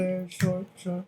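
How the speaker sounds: soft, slow put-on voice; lightly hammed-up tease; mock sultry aside. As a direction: drop into a soft, slow joking voice. Joking aside, odd voice.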